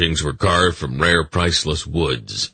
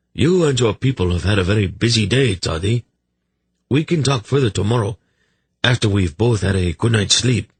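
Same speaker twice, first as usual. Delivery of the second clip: higher, quick royal voice; brisk, higher-voiced monarch; gracious king; 5 semitones higher than usual. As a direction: play a chatty king winding up the day.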